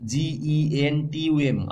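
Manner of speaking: slow letter-by-letter spelling of Argentum; slow dictation of the letters. Shown as a demonstration. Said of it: "আমরা Argentum".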